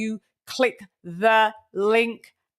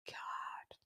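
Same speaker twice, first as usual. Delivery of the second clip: a breathy whispered "God."; whispered oath; 24 dB quieter than usual. Acted. Host ends his truth oath in a dramatic whisper. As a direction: drop to a hushed whisper on "God.".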